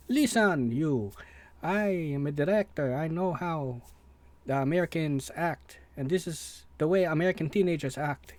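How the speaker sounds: a director's bad Italian accent, half-hearted. Mimicking the Italian director insisting in an Italian accent. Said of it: "in the Italian accent, which I did quite poorly".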